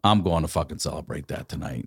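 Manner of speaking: low, soft, sincere reply; hushed, earnest promise; moved resolve. Retold or imitated. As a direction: answer quietly, taking it to heart. Moved answer to the nurse.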